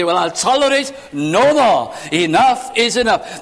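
preacher's mock indignant outburst; acted intolerance. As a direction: put your foot down in exasperation. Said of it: "That's the language of human beings".

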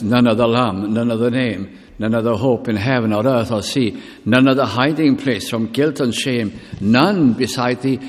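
reciting a hymn from the pulpit; hymn recited. Acted. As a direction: proclaim the hymn verse with rising force. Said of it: "One hymn puts it like this".

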